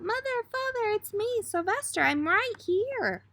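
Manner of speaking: high-pitched, pleading call to his parents; silent desperate shout. Acted. Trapped son calling out to his parents, high and pleading. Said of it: "He wanted to shout".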